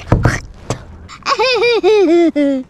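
loud, put-on creep's laugh; creepy laugh. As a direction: cackle like a creep, badly acting cool. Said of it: "I sound like a creep".

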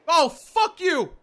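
high, loud, slow outburst; startled angry yell. Real, not acted. Player cursing at the game after a scare, shouting. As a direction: yell it in real shock and anger.

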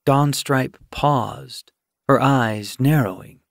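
plain reading voice

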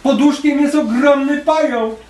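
put-on read-aloud frightened child; scared little girl. A narrator's reading. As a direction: say it like a small girl spooked by a spider. Scared girl voiced in story.

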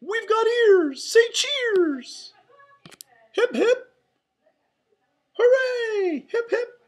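very high, loud cartoon cheering; Mickey. A narrator's reading. Mouse leading friends in hip hip hooray, squeaky cheering. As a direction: whoop it up in a squeaky falsetto. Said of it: "Mickey shouted".

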